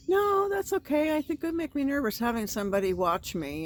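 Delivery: high, sweet no thanks; high polite refusal. Retold or imitated. To the young guy, sweet high no thanks. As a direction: wave him off sweetly.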